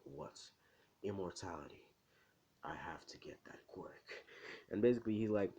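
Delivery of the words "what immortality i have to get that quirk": very soft, low, whispery villain aside; hushed villain scheming. Acted. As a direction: plot it under your breath, slowing into a whisper. Villain secretly watching, plotting aloud.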